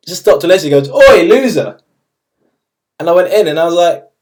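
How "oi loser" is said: loud, high call-out; imitated teacher's shout. History teacher calls to a pupil out in the hall, mock shout. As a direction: holler it like a teacher hailing a pupil.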